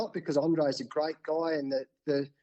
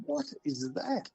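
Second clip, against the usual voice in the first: much higher, slow, puzzled question; baffled surprise; 10 semitones higher, 6 dB quieter, slower than usual. Retold or imitated. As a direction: draw it out, totally thrown. Baffled by meditation suggestion.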